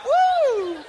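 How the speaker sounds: high "Woo!" whoop; party whoop. Acted. Intruder dancing and firing his gun, a high whoop. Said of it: "shooting it off, like".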